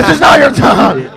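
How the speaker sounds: mock outraged shout. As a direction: shout down an intruder, hammed-up. Acting out the reason for firing.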